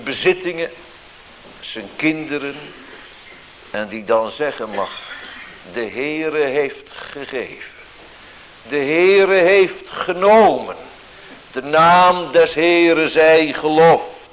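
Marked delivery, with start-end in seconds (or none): 5.7-14.3 plechtige lofprijzing. Retold or imitated. Job's words of praise quoted.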